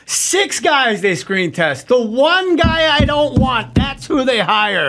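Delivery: high, drawn-out wail of mock outrage. Actress griping within earshot of her new co-star, indignant. Retelling the actress's loud complaint.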